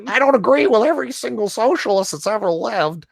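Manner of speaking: high-pitched put-on activist voice; mock an earnest larper in a squeaky high pitch; neat larper voice. Host mocking an article he can't read normally, high larper voice. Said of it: "the neat larper voice".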